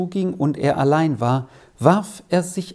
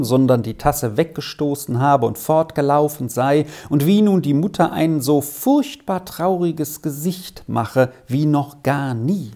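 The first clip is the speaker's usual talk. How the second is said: ordinary delivery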